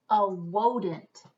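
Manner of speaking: "wodent" with a W sound for the R; "wodent" lisped W for R. Acted. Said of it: "he couldn't pronounce his R's".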